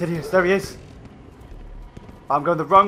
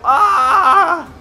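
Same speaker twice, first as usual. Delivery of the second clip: panicked screaming; 5 semitones higher, slower than usual. Real, not acted. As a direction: scream while being grabbed. Grabbed by the boss.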